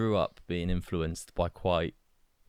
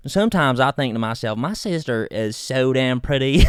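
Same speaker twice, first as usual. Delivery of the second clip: Southern US character; 3 semitones higher, 6 dB louder than usual. Acted. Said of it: "It was an accent. It was a character".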